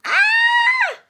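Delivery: very high loud shriek; playful fright for the child; mock scream. Mother shrieking in mock fright for Johan.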